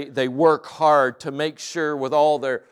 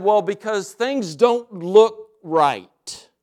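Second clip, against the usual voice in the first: put-on child's answer; 6 semitones higher, 4 dB louder, much slower than usual. Kid's answer voiced in story.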